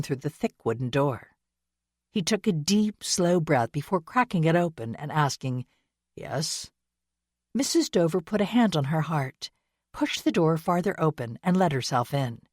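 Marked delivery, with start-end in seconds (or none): 6.2-6.7 quiet yes. Acted. Answers door after grief.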